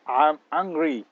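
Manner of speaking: a slow, firm "I'm angry"; angry intonation. Shown as a demonstration. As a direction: say "I'm angry" as if really cross, slowly. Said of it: "saya marah".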